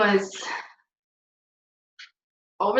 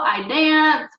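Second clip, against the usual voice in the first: ordinary talk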